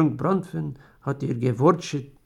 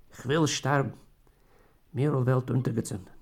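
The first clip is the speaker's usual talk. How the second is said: plain reading voice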